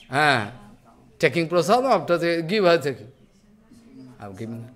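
usual speaking voice

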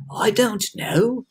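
put-on storybook old-timer, unhurried; old grasshopper. A narrator's reading. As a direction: answer in drawn-out elderly character. Old grasshopper answers kindly.

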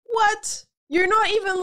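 high-pitched incredulous outburst; shocked disbelief. Real, not acted. Outraged at the treasure hunters.

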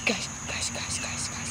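rapid hushed calling; urgent whisper. Real, not acted.

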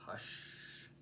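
soft whispered hush. Acted. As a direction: breathe out hush like a sleepy bunny. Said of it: "the old bunny whispering".

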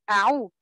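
أعو said with a glottal stop instead of the throat ع; wrong ain. Shown as a demonstration. Teacher demonstrates the wrong ain to trainee teachers. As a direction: show the mistake: hamza then عو. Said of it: "ما ينفعش ... أقول أعو".

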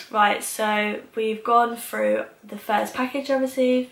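talking with mouthguard on; lisping around dental trays; teeth trays in mouth. Real, not acted. Seen 1: She fits trays before, then pulls lips back showing them worn.

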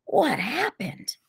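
slow, high, breathed-out shock; whispered exclamation. A narrator's reading. Stacy asks the injured T J, whispered exclamation. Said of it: "exclaimed in a whisper".

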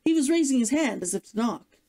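ordinary delivery, nothing marked

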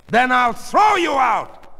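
slow, high, loud threat; shouted threat. Acted. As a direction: bellow it at a defiant daughter. Father threatens daughter.

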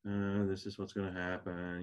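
flat, bored mock delivery with no urgency; monotone. Shown as a demonstration. Preacher mocking how Agabus did not sound, flat monotone. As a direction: say it like it doesn't matter at all. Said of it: "I don't see a monotone response here".

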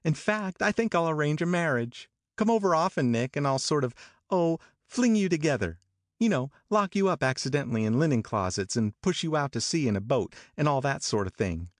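put-on high, playful teasing young woman; playful Daisy. A narrator's reading. Daisy teasing Nick about marriage, high and playful. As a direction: tease them about a match, high and playful.

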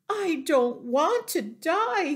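high, loud wailing lament; tearful moan. A narrator's reading. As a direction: moan it as a piglet in tears. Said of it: "he moaned".

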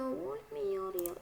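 slow soft whiny "Olmuyor ya"; playful whine. Shown as a demonstration. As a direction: drag "Olmuyor ya" out in a silly changed voice. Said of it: "repeated three ways".

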